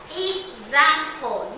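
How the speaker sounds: "Example" said very slowly as ex-am-ple; slow syllable demo. Shown as a demonstration. Teacher to class, splitting the word slowly. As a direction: draw "Example" out, one beat per syllable. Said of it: "that's the X A M".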